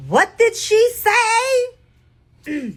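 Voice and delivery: high, slow, drawn-out confused question; mock a baffled fan shouting from the crowd; crowd member. Fan in the crowd shouting a confused question. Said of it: "I hear the person in the crowd like".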